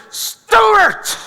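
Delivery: high, loud yell of a name; fuming shout. Retold or imitated. Upset girlfriend cutting off her boyfriend, fuming shout. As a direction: shout his name in mock fury. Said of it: "she's fuming mad".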